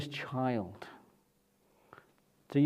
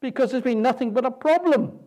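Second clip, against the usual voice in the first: higher, louder complaint of a worn-out Moses; exasperated Moses; 7 semitones higher, 4 dB louder than usual. Retold or imitated. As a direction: Moses venting to the Lord about his people. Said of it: "he's almost saying".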